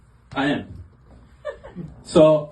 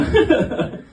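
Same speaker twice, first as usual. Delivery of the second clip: short high giggle; high laugh. Real, not acted. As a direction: laugh at your own dark joke. Laughs after option two punchline.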